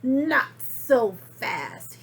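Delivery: slow, loud, menacing hiss; hissed warning. Acted. Strict new teacher halting the kids, slow and menacing. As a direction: stop them cold, slowly. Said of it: "hissed an unpleasant voice".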